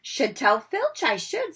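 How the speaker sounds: slow, high mock-pious; hammed-up saintly poltergeist. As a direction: fake innocence, slow. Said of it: "said Peeves in a saintly voice".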